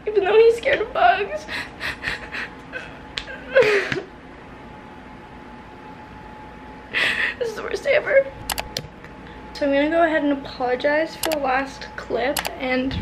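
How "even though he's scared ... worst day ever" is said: high, teary sob-laugh; whimpering through tears; crying. Real, not acted. She misses her boyfriend, crying over the bug.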